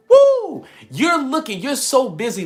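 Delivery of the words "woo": very high, very loud whoop; joyful whoop. Real, not acted. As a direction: let out a celebratory holler. Preacher whipping up the crowd.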